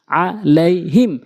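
each syllable set apart; slow demo. Shown as a demonstration. Teacher repeating so learners can follow, slowly. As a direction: break the word into slow pieces. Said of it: "slow বলছি এই জন্য".

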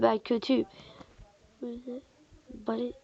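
normal talking voice

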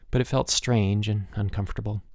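ordinary delivery

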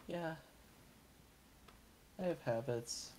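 normal talking voice

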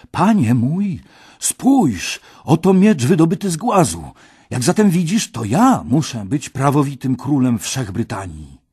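high, loud proclamation; triumphant announcement. A narrator's reading. Kej shows his father the sword, triumphant claim. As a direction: present the prize and claim the crown.

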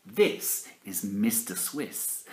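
plain reading voice

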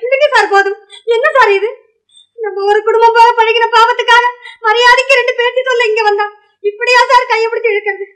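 high-pitched, upset pleading; aggrieved and high, objecting to rough handling; distressed protest. Acted. Woman protesting being grabbed by the hand.

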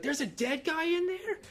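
very high, drawn-out shocked squeal; Chloe. Retold or imitated. Child learning what a hearse carries, shocked squeal. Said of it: "And then Chloe's like".

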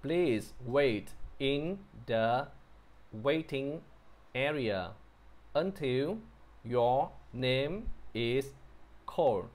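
very slow, word by word with pauses; spaced-out teaching read, each word set apart; careful model reading. Shown as a demonstration. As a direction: read it out slowly for learners to follow. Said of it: "mình đọc lại từ đầu".

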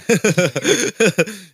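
high loud laugh. Real, not acted. Host laughs loud and high at the Gojek joke.

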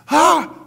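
loud, high startled yelp; sudden fright at a lion ahead; startled scream. Pretend. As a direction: jump back from a lion. Sudden lion in front of you.

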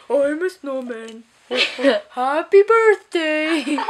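slow, goofy snowman voice; lightly voiced cartoon snowman; mock snowman. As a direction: drag it out like a silly talking snowman. Mocking snowman voice.

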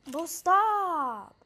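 high, loud drawn-out whine; distressed plea. Real, not acted. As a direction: beg loudly for it to stop. Begging the game to stop.

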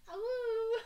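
high, loud little dog howl; corgi howl. Retold or imitated. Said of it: "with a nice little corgi going".

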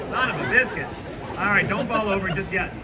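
ordinary delivery

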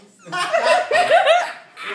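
a loud, high burst of laughter. Real, not acted. Laughing as Victor joins the game, loud high laugh. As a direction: crack up at her brother joining in.

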